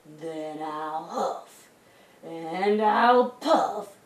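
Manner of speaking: slow threat building to a loud, high howl; big bad wolf winding up, then howling. A narrator's reading. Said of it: "howled the wolf".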